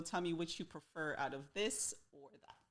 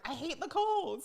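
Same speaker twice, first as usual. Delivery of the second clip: higher-pitched quoted grumble; a hint of mock complaint; complaining Londoners; 9 semitones higher than usual. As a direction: lightly imitate people moaning, pitch lifted. Host voices complaining Londoners.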